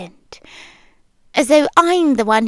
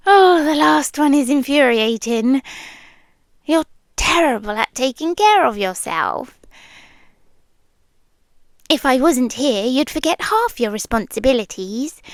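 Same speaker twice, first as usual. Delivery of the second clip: ordinary delivery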